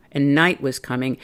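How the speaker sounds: ordinary delivery, nothing marked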